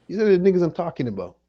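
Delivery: loud and fast, fed up; a loud, quick, fed-up outburst at the chat; exasperated outburst. Real, not acted. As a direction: blurt it out, sick of them.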